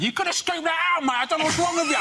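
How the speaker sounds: high teasing impression of a mate; imitating Rob. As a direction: squeak out his mate's scolding. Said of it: "But Rob said".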